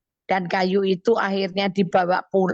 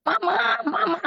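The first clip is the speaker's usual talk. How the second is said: imitated child's calling; 11 semitones higher than usual. Son calling his mother from afar, high mock shout. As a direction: call out like a distant child, voice pitched way up. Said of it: "dia udah teriak-teriak".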